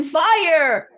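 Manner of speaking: put-on alarmed children's shout; boys yelling. A narrator's reading. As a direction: call out the warning. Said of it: "They started yelling".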